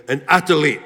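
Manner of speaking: "athlete" in a Philly accent, as "ath-a-lete". Shown as a demonstration. Speaker proudly naming his new status, "athlete" said Philly-style. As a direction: say "athlete" the Philly way, with an extra syllable. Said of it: "as we like to say in Philly".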